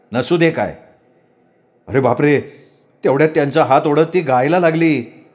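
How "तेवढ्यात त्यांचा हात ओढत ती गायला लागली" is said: ordinary delivery, nothing marked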